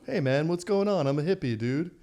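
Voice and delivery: a light imitation of mellow Seattle hippie speech; easy, drawled-out dude voice; laid-back hippie talk. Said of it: "talk like [name]".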